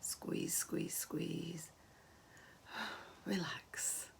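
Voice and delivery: very soft, near-whispered cues; hushed relaxation count; gentle coaching. Shown as a demonstration. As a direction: coax gently, barely voiced. Gentle tummy squeeze and release.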